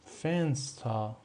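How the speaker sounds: فنستا slow, fenstaa; German Fenster demo. Shown as a demonstration. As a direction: model فنستا slowly, each sound clear. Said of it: "یا".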